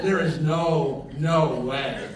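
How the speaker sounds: loud, slow, laughing "no way"; loud mock disbelief with a laugh; retold disbelief. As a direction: stretch it out in amazed laughter. Retold woman disbelieving at church sign.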